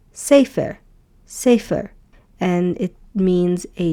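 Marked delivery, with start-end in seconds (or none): 0.1-1.9 sefer as SEH-fer, stress first; teacher modeling the word sefer. Shown as a demonstration. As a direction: say sefer clearly twice. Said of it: "And the word is".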